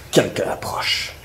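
slow, hushed warning whisper. Acted. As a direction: breathe it out, slowly, on guard. Captain warns of intruder. (chuchotement lent)

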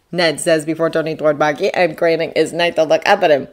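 ordinary delivery, nothing marked

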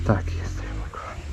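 tender whisper. Pretend. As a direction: breathe it softly. Whispering fondly to his motorbike.

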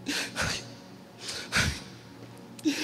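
ordinary delivery

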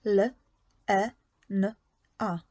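L, E, N, A each said alone with pauses; sounding out phonemes. Shown as a demonstration. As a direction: sound out the name letter by letter. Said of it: "ułóż schemat i model słowa Lena". (głoskowanie, każda głoska osobno)

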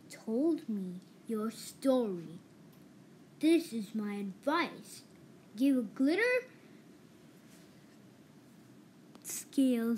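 lightly deepened wise octopus; deep voice. A narrator's reading. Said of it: "said the octopus with a deep voice".

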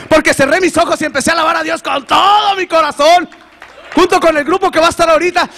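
high, loud, fervent testimony; rising, loud pulpit voice; preaching at full force. Acted. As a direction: preach it out to the congregation. Praise climax preached loudly.

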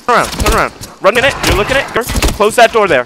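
high, loud furious kill orders; battle shouting. Real, not acted. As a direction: bellow at your teammate to finish him. Chasing enemy opening portal.

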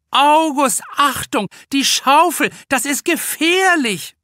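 overprotective mother. Acted. Mother in a beach chair warning her son August, high panicked call. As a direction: cry out in panic over the shovel. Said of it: "die überbesorgte Helikoptermutter".

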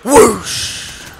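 loud airy whoosh noise; whoosh sound effect. Pretend. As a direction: make a swooshing noise. Imitated whoosh.

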